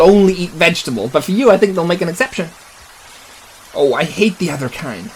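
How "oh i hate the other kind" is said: ordinary talk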